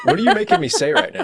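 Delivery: cracking up, suspicious of the trick; laughing. Real, not acted.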